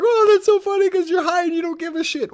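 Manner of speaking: very high, loud mock gushing; gushing fan impression. Everyone fawning over the high comedian, mocking squeal. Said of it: "and everyone goes like".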